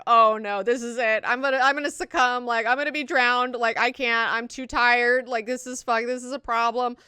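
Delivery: mock panic. [name] succumbing, panicked.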